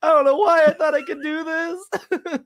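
high, teary mock sob; hammed-up stage breakdown; mock crying. Co-host joins the bombing bit, mock crying. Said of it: "I'd probably just start crying".

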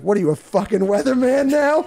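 high-pitched, incredulous gruff-sergeant retort. Acted. Quoting the old sergeant snapping at his private, gruff. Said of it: "He's so gruff".

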